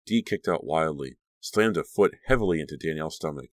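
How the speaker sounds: plain reading voice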